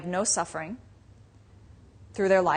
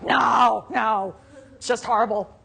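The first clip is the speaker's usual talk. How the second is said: put-on louder, half-laughing toddler-like refusal; mock whiny protest; 3 semitones higher, 5 dB louder, slower than usual. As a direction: play the stubborn mind saying no. Mind rejecting the teaching.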